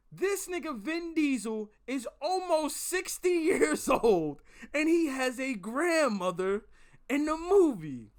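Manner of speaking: loud, high, slow outraged yell; imitated screaming. Livid friend explaining his movie complaint, exasperated yelling. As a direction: spell out the outrage at full volume. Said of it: "still in public screaming about some".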